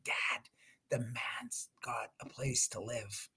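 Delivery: put-on slow, soft breathy whisper of a child; hushed little girl. Dad retelling his daughter noticing the man is gone, slow soft whisper. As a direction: whisper it slowly like a secret discovery.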